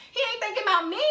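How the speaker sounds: high-pitched, loud mock exasperation; exasperated. Telling herself he isn't interested, high-pitched exasperation. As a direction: shoot up high in indignant self-reproach. Retelling own exasperated realization.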